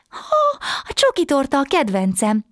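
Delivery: very high and loud with joy; a child squealing over her favorite cake; girl hears about chocolate cake, thrilled and loud; delighted cry. Acted. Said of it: "kiáltott fel Matilda".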